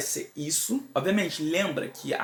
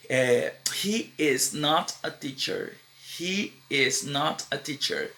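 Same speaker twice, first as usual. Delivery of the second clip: slow model sentence; slower than usual. Shown as a demonstration. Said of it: "teacher models sentence slowly".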